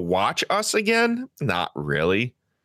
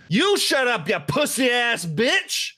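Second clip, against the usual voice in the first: imitating a shout; 9 semitones higher than usual. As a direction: yell it like the rude guy in the theater.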